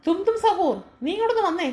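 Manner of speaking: high, loud witch's summons; old witch shouting for Sahoor; witch calling out. Acted. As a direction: call the helper like a cackling hag. Witch calling her helper.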